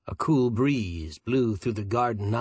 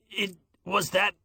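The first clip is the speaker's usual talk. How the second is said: thick voice; 8 semitones higher than usual. A narrator's reading. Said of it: "He said thickly".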